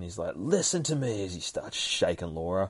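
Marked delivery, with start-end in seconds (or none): 0.4-1.2 higher-pitched imitation of screaming; half-hearted retold scream, pushed up in pitch; urgent mock yell. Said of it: "Dakin starts screaming".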